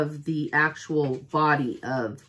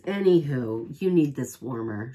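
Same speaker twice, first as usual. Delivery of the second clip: ordinary talk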